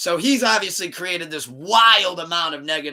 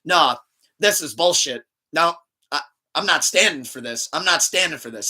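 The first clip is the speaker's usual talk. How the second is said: loud, emphatic mock outrage; retold stubborn protest, punched out; indignant refusal; 4 dB louder than usual. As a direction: imitate a friend digging in. Friend indignantly refuses at the counter.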